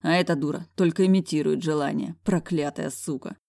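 ordinary delivery

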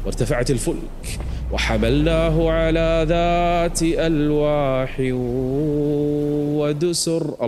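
drawn-out recited verse; slow Quran recitation. Acted. Preacher reciting the ark verse, slow. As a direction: chant the verse slowly.

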